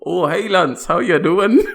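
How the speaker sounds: hammed-up Canadian friend greeting; Canadian accent. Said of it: "This is perfect Canadian accents".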